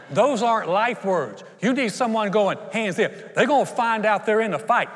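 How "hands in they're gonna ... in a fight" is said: loud, raised-pitch rallying cry; hammed-up vocal team captain; team pep talk. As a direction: fire up the team before the game. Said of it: "they usually get the most vocal person on the team".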